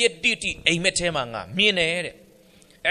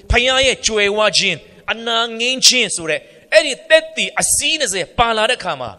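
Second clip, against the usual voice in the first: louder, higher preaching push; rising preaching; 6 semitones higher, 6 dB louder than usual. Real, not acted. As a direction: drive the point home to the congregation. Preacher building to a climax.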